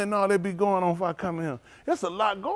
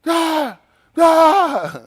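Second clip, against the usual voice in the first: high, loud mock yell of frustration; imitated computer screaming; 12 semitones higher, 12 dB louder than usual. Mocking Dwayne, who yells at his computer, with a loud high mock scream. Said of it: "just be screaming at that computer".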